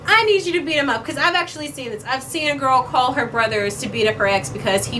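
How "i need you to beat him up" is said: mock whine. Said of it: "be like".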